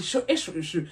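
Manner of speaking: usual speaking voice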